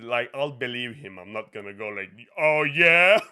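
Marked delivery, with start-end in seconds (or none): 2.4-3.2 loud, challenging 'oh yeah?'; mock confrontation. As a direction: square up loudly, as if picking a pretend fight. Mimes picking a fight.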